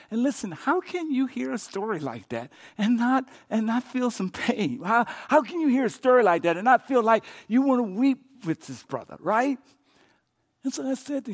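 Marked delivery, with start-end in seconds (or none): 0.1-9.6 loud, high, impassioned preaching; preaching at full force. Acted. Pastor urges congregation to weep with brother, impassioned preaching. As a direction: pour out the pain to the congregation.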